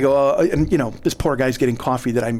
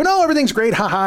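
much higher-pitched forced cheer with fake laugh; falsely cheerful; 8 semitones higher, faster than usual. Clerk hiding the robbery from a customer, falsely cheerful. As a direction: cover panic with high, fake brightness.

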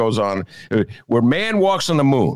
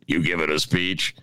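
put-on Brooklyn accent; 5 semitones lower, 3 dB quieter than usual. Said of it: "with that booming Brooklyn accent".

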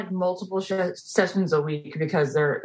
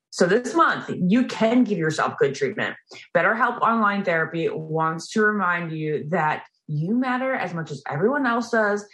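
upbeat sponsor read, slightly higher; 5 semitones higher than usual. Acted. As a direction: read the ad like an announcer. Reads the sponsor ad.

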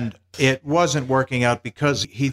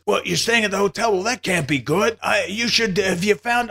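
higher, concerned Mel Brooks voice; 9 semitones higher than usual. Retold or imitated. Mel Brooks fretting about Eugene's hotel, higher. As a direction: fuss over him a bit. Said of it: "And he says".

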